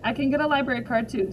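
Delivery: usual speaking voice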